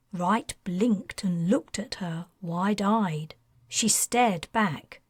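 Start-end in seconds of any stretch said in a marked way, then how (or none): none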